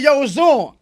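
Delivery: retold rallying call. Inmate announcing a fight to the yard, loud retold call. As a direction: call it out like a challenge.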